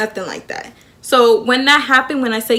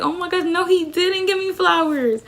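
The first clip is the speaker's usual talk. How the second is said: happy disbelief; 7 semitones higher than usual. Acted. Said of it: "I was so happy".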